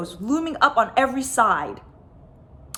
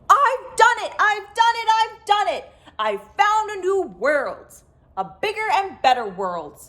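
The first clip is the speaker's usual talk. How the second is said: excited shout; 10 semitones higher than usual. A narrator's reading. Spaceman returning to the crowd, shouting excitedly. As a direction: burst out with the good news. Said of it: "the man hopped out shouting".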